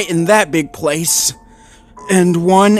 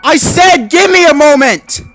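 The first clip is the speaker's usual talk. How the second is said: much higher, louder frantic shout; cried out; 8 dB louder than usual. Acted. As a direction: yell back in panic. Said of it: "the Dane cried".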